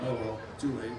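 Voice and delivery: ordinary delivery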